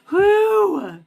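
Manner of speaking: high, loud laughing burst then a relieved whew; burst of laughter. Real, not acted. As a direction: laugh it off, then blow out a breath. Laughing at her own rant.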